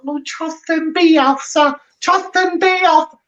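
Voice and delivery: high, slow sing-song mockery of fans; mocking fan voice. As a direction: whine it out like a smug fan. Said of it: "what was that voice?".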